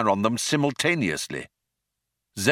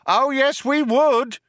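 much higher, louder shout; cop shouting; 4 dB louder, slower than usual. A narrator's reading. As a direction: shout it back. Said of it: "shouted the cop".

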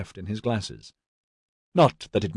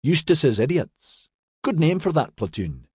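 tickled, pitched up; grinning; 6 semitones higher than usual. A narrator's reading. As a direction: say it through a grin, savouring the nickname. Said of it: "turned to Dinley and grinned".

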